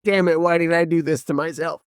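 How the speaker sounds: high-pitched exasperated self-reproach; mock exasperation. Acted regretful outburst of a parent.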